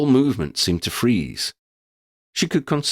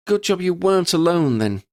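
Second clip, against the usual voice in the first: put-on much higher, teasing young voice; grinning Lydia; 10 semitones higher than usual. A narrator's reading. Lydia teases the professor, high and playful. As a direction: tease him with a grin you can hear. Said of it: "Lydia grinned".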